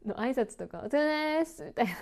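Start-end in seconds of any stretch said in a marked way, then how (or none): 0.9-1.6 high "お疲れです" with the middle swallowed; casual sloppy "お疲れです", lively only at the end; slurred, peppy greeting. Shown as a demonstration. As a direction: blur "お疲れです" and stretch a bright "です". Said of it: "だけ元気".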